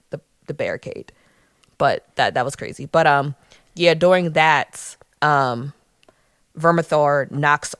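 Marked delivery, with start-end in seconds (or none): none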